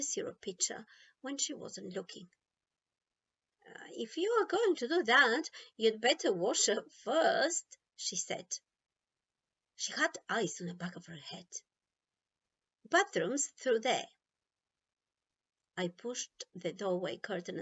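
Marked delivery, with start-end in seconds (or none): 3.7-7.8 higher and louder, a sharp grandma telling off; grandma's warning. A narrator's reading. Grandma catching Nicky with the syrup, loud warning. 12.9-14.1 louder, brisk grandma instruction; grandma pointing the way. A narrator's reading. Grandma sending Nicky to wash, brisk and louder.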